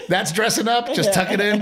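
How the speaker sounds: laughing. Retold or imitated. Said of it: "And she was like".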